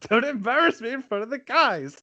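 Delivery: very high, put-on whiny plea; mock pleading. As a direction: squeal it in a teasing falsetto. Mimics the embarrassed plea back.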